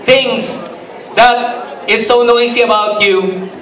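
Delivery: a pastor at full lift; preaching. Acted. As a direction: preach it out to the hall. Preaching at raised pitch.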